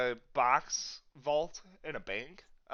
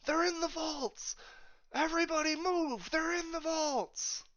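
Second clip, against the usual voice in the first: high, strained mock shout without real volume; shouting from below. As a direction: call the alarm up from floors below. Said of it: "you can hear shouting from below".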